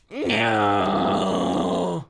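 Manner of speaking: long, loud drawn-out cry; stretched mock yell; character cry impression. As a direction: wail it out loud, imitating a character. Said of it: "That's how he sounds".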